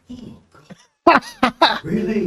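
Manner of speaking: high, loud laughing oh God; laughing in disbelief. Real, not acted. Recognized on the street by the lady boys, he laughs in disbelief. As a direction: laugh it out, caught red-handed.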